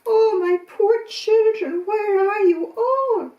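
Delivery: high, loud, slow anguished wail; crying mother. Acted. Mummy Goat cries for her missing children, high and slow. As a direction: call out for lost children in grief. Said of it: "she started to cry".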